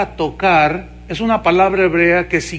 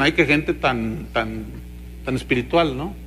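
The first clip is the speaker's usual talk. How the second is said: sarcastic mock; 6 semitones lower, 9 dB quieter than usual. Preacher voicing his laughing listeners, mock-pious sarcasm. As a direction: sneer that they are so spiritual. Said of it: "ustedes se ríen porque dicen".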